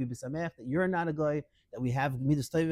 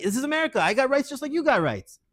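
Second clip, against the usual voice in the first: defiant; 10 semitones higher, 6 dB louder than usual. Retold or imitated.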